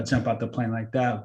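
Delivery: ordinary delivery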